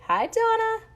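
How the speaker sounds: high, bright hello to a viewer; high excited greeting. Real, not acted. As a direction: sing out the greeting.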